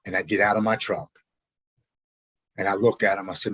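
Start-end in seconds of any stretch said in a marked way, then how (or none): none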